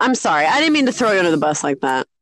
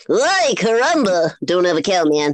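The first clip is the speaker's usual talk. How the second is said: cartoon bratty boy catchphrase voice; Bart Simpson; 3 semitones lower than usual. Shown as a demonstration. Showing a rusty old impression, cartoon kid voice. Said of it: "I only know how to do Bart Simpson".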